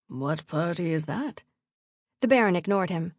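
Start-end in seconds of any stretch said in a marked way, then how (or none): 0.1-1.4 low, slow character voice; curious team member. A narrator's reading. Gaius asking about the party, probing. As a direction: ask it slowly in a lower voice.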